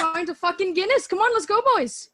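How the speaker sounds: put-on loud, high Irish accent, pub cheer; rowdy Irish lad heading for a Guinness. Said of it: "I can definitely speak in an Irish accent".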